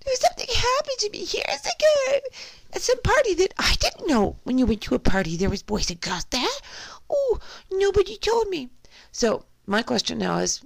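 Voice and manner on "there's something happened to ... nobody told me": high mocking falsetto, far above his own voice; exaggerated falsetto parody of a woman's testimony; mocking Christy Blasey Ford. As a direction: pitch way up into a mock-victim voice to ridicule her. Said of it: "I'm gonna pretend like I'm Christy Blasey Ford".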